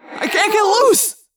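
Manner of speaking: loud, high, slow desperate yell. Acted. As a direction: strain and yell while stuck. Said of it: "Jake yelled".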